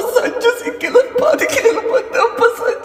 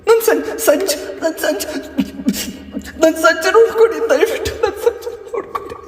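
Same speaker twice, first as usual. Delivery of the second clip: desperate sobbing plea, breaking into cries; 2 semitones lower than usual. Acted. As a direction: beg through tears for her lost son. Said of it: "ಯಾಕೆ ಹಿಂಗ್ ಅಳ್ತಾ ಇದೀಯಾ?". (ಬಿಕ್ಕಿ ಬಿಕ್ಕಿ ಅಳುತ್ತಾ ಬೇಡಿಕೆ)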